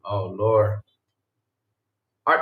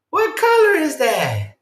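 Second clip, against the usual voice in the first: high, slow cartoon-kid voice, much higher; sing-song kids' show host quizzing the viewer; lightly hammed-up children's TV host; mock Dora; much slower than usual. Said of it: "she's like this".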